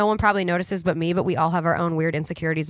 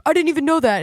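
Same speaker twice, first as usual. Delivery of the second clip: surprised; 8 semitones higher, 5 dB louder, faster than usual. Retold or imitated. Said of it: "Hardy's like".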